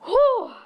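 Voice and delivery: high, loud exhale of relief; relieved whew. Retold or imitated. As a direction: blow out a big breath of relief. Relief after escaping.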